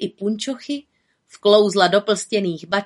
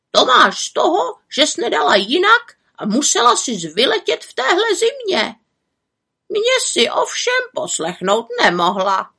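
croaking raven; 11 semitones higher, 4 dB louder than usual. A narrator's reading. Raven scolds the frozen witch, croaking. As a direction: croak it like a know-it-all raven. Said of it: "krákal Havran".

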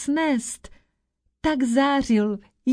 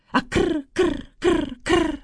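raven caws; 5 semitones higher than usual. Retold or imitated. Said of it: "Ozývalo se to ze všech stran".

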